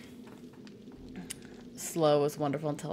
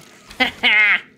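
loud laugh; 5 semitones higher, 12 dB louder than usual. Real, not acted. Laughing after hitting zombie.

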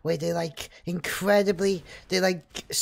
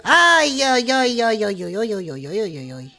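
alarmed wail; 3 semitones higher, 7 dB louder than usual. Real, not acted. Streamer fleeing to get out of the way, alarmed wailing. Cries out under attack.

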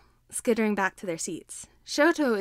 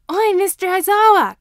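put-on much higher and louder, a brash kid's shout; yelling boy; 11 semitones higher, 12 dB louder than usual. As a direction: call out across the room to get the teacher's attention. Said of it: "Katsuki yells".

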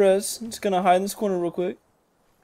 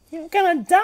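taunt; 10 semitones higher than usual. Pretend. Taunting high voice.

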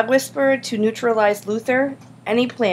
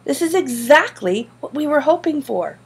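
higher-pitched, eager assurance; insistent; 7 semitones higher than usual. A narrator's reading. Boy tells upset classmate it all worked, insistent. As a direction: press the point brightly. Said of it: "Griffin insisted".

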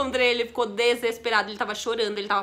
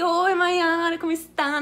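mock eager suitor; 5 semitones higher, 4 dB louder, slower than usual. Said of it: "E aí ele".

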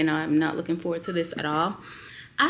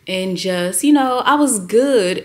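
excited; 3 semitones higher, 8 dB louder than usual. Real, not acted. Upbeat part of her story.

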